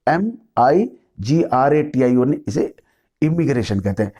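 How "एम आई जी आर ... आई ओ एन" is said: letter by letter, slightly slow: एम आई जी आर ए टी आई ओ एन; spelling it out. Shown as a demonstration. Teacher to students, spelling out the word, letter by letter. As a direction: spell each letter of एम आई जी आर ए टी आई ओ एन clearly for the class. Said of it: "आई double".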